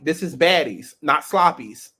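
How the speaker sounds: ordinary delivery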